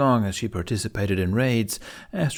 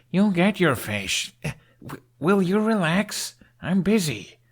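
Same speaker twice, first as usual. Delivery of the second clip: higher, slow, fed-up placating; exasperated; 9 semitones higher, slower than usual. Acted. Hiccup placating his fish-demanding dragon, high exasperated. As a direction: hush a pestering pet through clenched patience.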